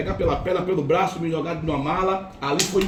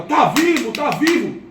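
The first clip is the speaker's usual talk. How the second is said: retold shout; 7 semitones higher, slower than usual. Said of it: "aí gritaram".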